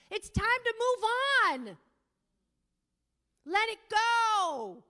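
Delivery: loud, fervent sermon cry; preaching at full force. Acted. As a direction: rouse the congregation.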